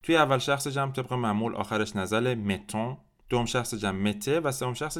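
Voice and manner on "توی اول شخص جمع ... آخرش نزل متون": ordinary talk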